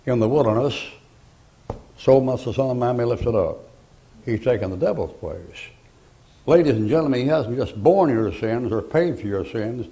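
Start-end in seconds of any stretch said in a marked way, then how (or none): none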